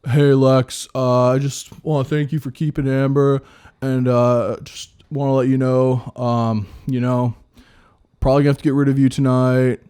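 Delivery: playing Rob. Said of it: "I'll be Rob".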